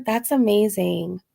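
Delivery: ordinary talk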